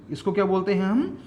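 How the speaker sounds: normal talking voice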